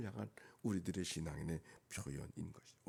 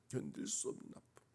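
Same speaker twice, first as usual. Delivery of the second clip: hushed anguish; 4 dB quieter than usual. Real, not acted. Preacher repeats his pain to himself, hushed anguish. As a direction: drop to a pained hush.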